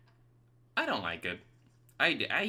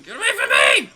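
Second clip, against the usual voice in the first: shrill-pitched yell, much louder; high shout; 12 semitones higher, 15 dB louder, faster than usual. Real, not acted. Gamer screaming at a ghost to back off.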